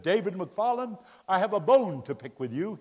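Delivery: mock confrontation, loud and emphatic; confronting big man. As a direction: imitate a big man picking a quarrel. Big man confronting him, poking chest.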